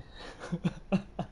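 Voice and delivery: quiet airy chuckle; soft breathy laugh. Real, not acted. Streamer laughing at a viewer's odd story, soft breathy chuckle. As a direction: laugh under your breath in disbelief. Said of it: "I'm not laughing, uh, at you".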